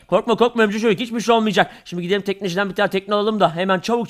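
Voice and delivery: normal talking voice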